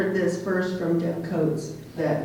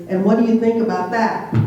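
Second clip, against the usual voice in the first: higher, louder imitation of an interviewer; quoted interviewer; 4 semitones higher, 7 dB louder, faster than usual. Retelling a radio interview to an audience, interviewer's louder question. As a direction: put the question loudly, imitating the radio man.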